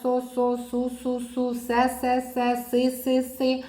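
speech therapist syllable drill. Shown as a demonstration. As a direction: chant the syllables for the child. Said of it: "І потім вже слова".

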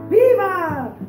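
long, high, loud hurrah; shouted cheer. Real, not acted. Teacher cheering the snail, high drawn-out shout. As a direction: cheer it out for the whole class. Cheer inside class song.

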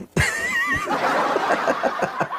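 long breathy laugh; drawn-out laugh at the joke. Real, not acted. Co-host laughing after own skincare joke, breathy laugh.

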